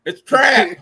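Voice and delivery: loud, high Kentucky twang; hammed-up Southern accent. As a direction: yell it like a Kentuckian. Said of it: "From Kentucky".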